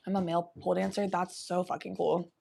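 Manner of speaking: ordinary talk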